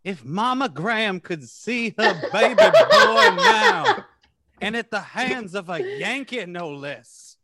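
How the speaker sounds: a hint of a scandalized Southern mama; Southern drawl. Last joke about the senator, mock Southern lament. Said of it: "vaguely polite Southern".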